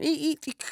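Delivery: high-pitched squeaky eek eek eek; sneaker squeak. Retold or imitated. Storyteller imitating sneakers squeaking during a fight, high and squeaky. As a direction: squeak like sneakers skidding on a court.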